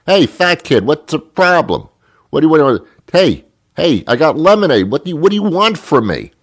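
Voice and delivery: put-on high, loud kid yelling; yelling at passersby. Kid at a lemonade stand yelling at passersby, loud and high. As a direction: bark it at passing strangers, fed up. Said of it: "I started yelling at people as they were going by".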